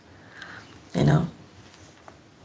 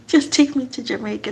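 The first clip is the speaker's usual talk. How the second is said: laughing; 5 semitones higher than usual. Real, not acted. Repeats quote laughing.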